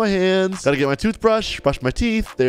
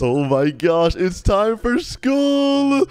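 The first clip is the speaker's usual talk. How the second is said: slow, pitched-up hype; excited; 2 semitones higher, slower than usual. Pretend. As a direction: stretch it out with rising glee. Hyped child heading to school.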